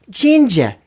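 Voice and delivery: high-pitched, insistent mock UK lad voice; chavvy British heckler. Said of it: "He goes".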